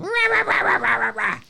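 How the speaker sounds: high, mock-nagging "rah, rah" babble; imitating nagging. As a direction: ape the lady griping on and on. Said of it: "Exactly like that".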